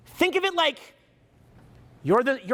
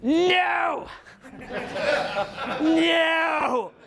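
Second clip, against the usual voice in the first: high, shouted no broken by a laugh; shouted refusal; 6 semitones higher than usual. Acted. Someone pulled toward the light, laughing shouted no. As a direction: bark a no, laugh, bark it again. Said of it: "pulled, you're like".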